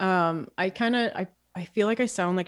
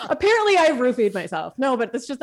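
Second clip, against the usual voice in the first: louder, higher, laughing admission; real laughter in the voice; amused laughing; 4 semitones higher, 7 dB louder than usual. Real, not acted. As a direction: laugh through it as you own the silly slip. Laughing at own slip of tongue.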